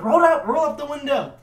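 high, loud pleading command; imitated urgent order. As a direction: play the girls shouting at their friend. Girls urging their friend in story.

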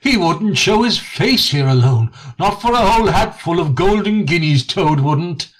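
poking fun at a boastful friend; Rat, amused. Acted. Rat assuring Mole that Toad is a coward, amused.

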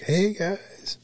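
high squeaky mock "Hey guys"; mock high-pitched hello; imitating her high greeting. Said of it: "It's usually like".

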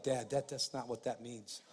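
lightly voiced teenage son correcting his father; quiet imitation of a son's correction; sons correcting dad. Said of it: "they're like".

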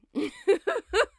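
high chirpy burst of laughter; bird-like laugh. Real, not acted. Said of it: "like a bird".

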